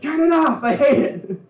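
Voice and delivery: put-on high, loud whiny kid yell. Kid in the car yelling at dad to turn it off. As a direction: yell like a kid sick of the music. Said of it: "My dad would play it in the car and be like".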